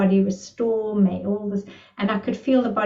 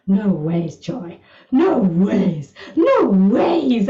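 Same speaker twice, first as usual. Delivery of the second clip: drawn-out, swooping cries of amazement; building incredulous exclamation, imitated; retold disbelief; 4 dB louder, much slower than usual. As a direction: imitate someone astounded, each repeat bigger. Retelling his amazed reaction to video.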